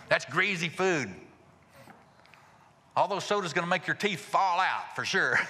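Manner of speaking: high, mock-scolding health lecture; nagging warning. Nagging someone about greasy food and sodas, mock scolding.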